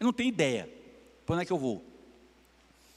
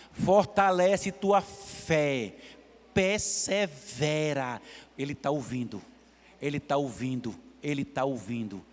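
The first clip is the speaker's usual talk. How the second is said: tender preaching; 7 semitones lower than usual. Acted. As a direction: soothe the grieving listener. Preacher consoling the congregation.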